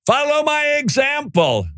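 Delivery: high, ringing call; called-out cry. Acted. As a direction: shout it out while running down the pier. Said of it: "he goes running down the pier".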